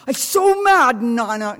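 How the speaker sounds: put-on high, loud, furious little boy; grandson's mad voice. Grandma imitating her grandson being mad. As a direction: stamp it out like a cross toddler. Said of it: "He goes".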